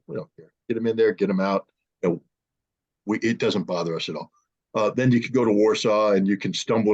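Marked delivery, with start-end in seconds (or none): 0.1-4.3 blunt Russians. Retold or imitated. Russians answering the deconfliction call, low offhand dismissal. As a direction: shrug it off like it's nothing. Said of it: "And the Russians went".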